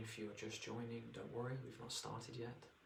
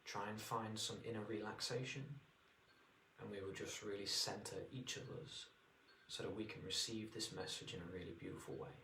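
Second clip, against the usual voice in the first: guided meditation. Acted. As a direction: ease them into relaxation.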